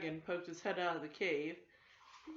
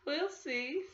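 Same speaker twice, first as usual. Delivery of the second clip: knowing laugh; 8 semitones higher than usual. Acted. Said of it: "laughing to herself".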